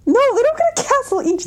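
high-pitched exasperated protest; exasperated correction. Real, not acted. Exasperated correction of co-host's misunderstanding.

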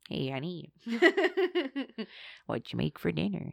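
a hint of a frail old man; soft, small tired husband voice. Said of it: "this little frail old man".

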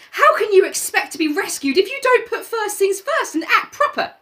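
put-on high boy lecturing the group; Piggy's tirade. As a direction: scold them like a fussy boy. Piggy voice held.

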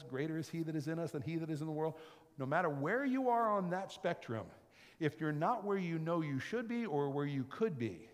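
ordinary talk

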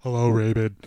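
low, stuffy sick-man voice; low croaky sick voice; sick guy voice. Pretend. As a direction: sound congested and ill. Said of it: "You just sound like a sick guy".

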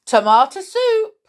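high, loud call; crying out. A narrator's reading. Big bear yelling down the mouse hole, crying out. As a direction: yell it down a hole. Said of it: "cried the big bear".

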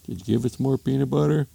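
husband asking. Acted. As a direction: ask it in a mild cartoon husband voice. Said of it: "Husband comes downstairs".

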